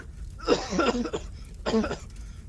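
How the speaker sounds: rough smoky coughing fit; coughing in the burning hall; choking cough. Acted. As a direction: choke on the smoke. Choking smoke.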